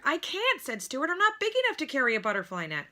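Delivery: high, fast little voice; quick high mouse voice; small Stuart. A narrator's reading. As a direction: protest in a tiny voice.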